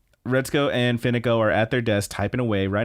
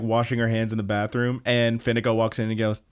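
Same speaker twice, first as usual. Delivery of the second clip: ordinary talk, nothing marked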